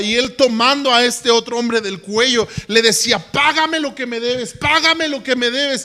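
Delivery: high, loud preacher storytelling; fervent preaching. Acted. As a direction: preach the parable with rising fire. Preaching the parable at full force.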